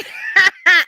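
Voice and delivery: high, loud burst of laughter. Real, not acted. Laughs at own failed accent.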